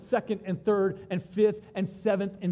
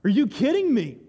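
higher and louder, stunned and thrilled; loud disbelief; 4 semitones higher, 7 dB louder than usual. Retold or imitated. Israelites at the Red Sea, loudly amazed. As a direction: shout it in amazed delight. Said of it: "they'd be like".

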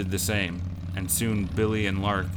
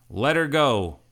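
loud, higher shout; shouted command; 7 semitones higher, 6 dB louder than usual. A narrator's reading. Billy steps out of hiding to save Lark, shouting. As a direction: shout it out to stop them.

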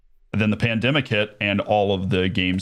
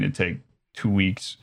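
usual speaking voice

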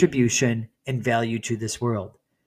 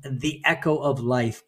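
usual speaking voice

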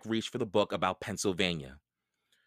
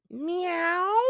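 very high cat meow. A narrator's reading. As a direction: mew like a cat. Cat meows.

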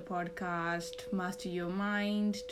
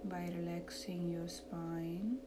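soft, slow meditation voice; calm, hushed, lead them into relaxation; guided meditation; 8 dB quieter than usual. Acted.

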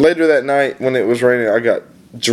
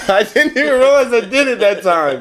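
high, laughing through the words; 12 semitones higher than usual. Real, not acted. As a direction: laugh while admitting the slip. Laughing at own slip.

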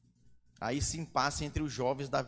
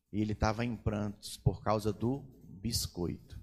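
usual speaking voice

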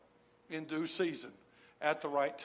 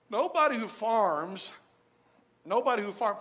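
1 emphatic repeat; 4 semitones higher, 8 dB louder than usual. Real, not acted.

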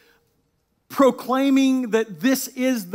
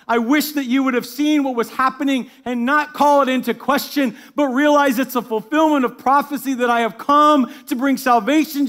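louder, higher pleading lament; impassioned plea; 4 semitones higher, 7 dB louder than usual. Acted. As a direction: plead with the city, aching. Preacher voicing Jesus' lament.

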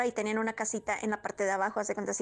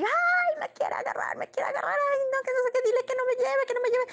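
panicked cry pitched much higher, half-whispered in the middle; terrified scream; 13 semitones higher than usual. Retold or imitated. Woken by a shadow creature, screaming in panic. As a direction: beg in terror as something grabs at you. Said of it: "empecé a gritar".

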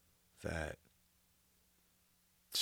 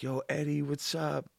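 excited greeting; 7 semitones higher than usual. Retold or imitated. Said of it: "People are like".

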